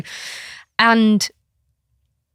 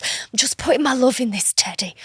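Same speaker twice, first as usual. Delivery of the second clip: higher, lightly voiced little girl; child's explanation; 4 semitones higher than usual. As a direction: imitate a child busy with her teddy. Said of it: "She goes".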